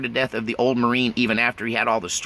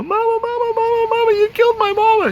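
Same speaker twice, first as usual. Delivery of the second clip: high, loud frantic calling for mama; a terrified boy shrieking over his mother; panicked child cry; 7 dB louder than usual. Retold or imitated. Boy at his unconscious mother, panicked high cry.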